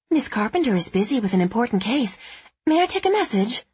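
put-on coy old-movie receptionist, quick; film noir secretary. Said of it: "imitating the breathy voice of a film noir secretary".

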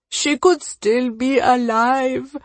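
acted tearful regret, stretched out; crying regret. A narrator's reading. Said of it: "he cries".

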